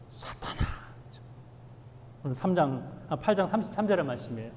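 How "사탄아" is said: whispered, breathy name; hushed whisper. Retold or imitated. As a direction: breathe the name like a dark realization. Quoting Jesus naming Peter. (예수님이 베드로를 부르며, 숨죽여 속삭이듯)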